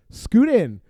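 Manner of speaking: loud and playful, inviting; loud playful invitation. Retold or imitated. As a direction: call it out with a grin. Gaga invites him over.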